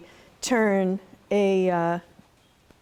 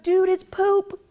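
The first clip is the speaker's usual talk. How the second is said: high mock yell; screamed out; 10 semitones higher, 4 dB louder, slower than usual. Mom yelling to her son across the farm, mock scream. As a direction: shout it in alarm to the boy. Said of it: "she just screamed out".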